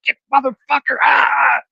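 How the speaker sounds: high mock scream, ending in a wordless yell. Driver re-enacting a grasshopper attack in his car, high screaming. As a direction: scream curses in a hammed-up panic. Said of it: "I'm screaming".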